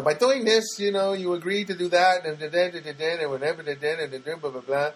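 mock legal boilerplate, trailing into gabble; lawyer talk. Agent reading the caller legal terms, mock droning gabble. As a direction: rattle off meaningless fine print, starting high and settling down. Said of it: "this lawyer talk".

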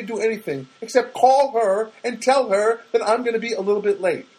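high, exasperated insistence; irritated, pleading caller; irate. Retold or imitated. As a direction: spell it out to someone exasperating. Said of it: "I think I said it like that".

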